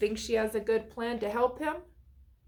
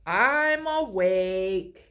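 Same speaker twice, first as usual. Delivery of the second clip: slow, sleepy reply; tired voice; 3 semitones lower, 4 dB louder, much slower than usual. A narrator's reading. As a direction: drag the words out, still half asleep. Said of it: "his tired voice".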